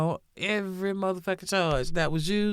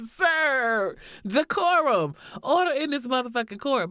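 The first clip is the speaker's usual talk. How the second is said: higher, louder mock scolding; mock stern call to order; 8 semitones higher, 4 dB louder than usual. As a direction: call the court to order, hammed-up.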